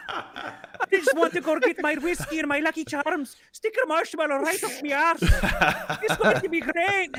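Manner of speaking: high-pitched stage-Irish leprechaun; hammed-up Irish brogue, giggly; gleeful cartoon Irish voice; mock Irish accent. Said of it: "I'm gonna do an accent for you".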